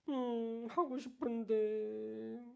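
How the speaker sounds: soft, very slow little voice; meek child. Retold or imitated. A hint of a shy child holding back a wish, soft. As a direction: sound small and pitiful. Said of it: "이렇게 울면".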